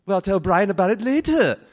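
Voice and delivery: Stewie. Acted. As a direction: toss it off in a high character voice. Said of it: "And Stewie would say".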